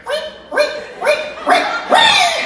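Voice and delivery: very high squeaking reek sounds; high squeaky boot noise imitation. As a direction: squeak out the galoshes noise, rising. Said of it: "I hear".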